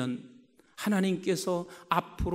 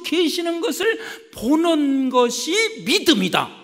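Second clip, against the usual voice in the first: raised, insistent pulpit voice; emphatic preaching; 9 semitones higher, 6 dB louder than usual. Acted. Preacher restates what faith is, emphatic preaching. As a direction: drive home the definition at a higher pitch.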